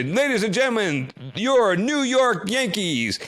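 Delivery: high, slow, drawn-out ballpark announcer call; stretched, lifted announcer's intro to the crowd; stadium announcer. Retold or imitated. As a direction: boom it out like the PA man welcoming the team.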